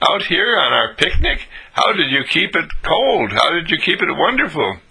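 high-pitched astonished questions; incredulous. Acted. Offered ice cream on a picnic, incredulous. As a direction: ask in wide-eyed amazement, pitched high. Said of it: "you were incredulous about it".

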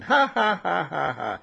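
imitating students laughing at him; mock laughter. Teacher mimicking students laughing at him, mock laughter. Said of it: "And you laugh at me".

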